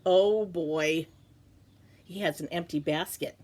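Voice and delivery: ordinary delivery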